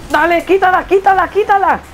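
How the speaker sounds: high, excited rapid-fire urging; urgent calling out. Real, not acted. Gamer telling teammate to steal the ball, urgent high call.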